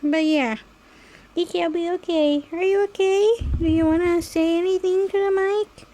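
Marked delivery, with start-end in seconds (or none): none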